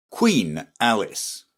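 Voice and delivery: ordinary delivery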